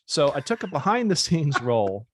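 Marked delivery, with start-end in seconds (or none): none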